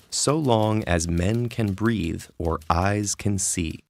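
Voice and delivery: slow, measured line with each stress marked; rhythmic poetry reading. Shown as a demonstration. Narrator reciting Shakespeare to show rhythm, slow and measured. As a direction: lean on the beat of every syllable. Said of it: "the rhythm of language, created by syllables and their emphasis".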